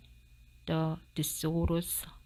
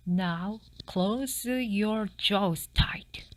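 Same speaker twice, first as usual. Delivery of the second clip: slow instruction; 2 semitones higher, much slower than usual. Acted. Dentist instructs fox.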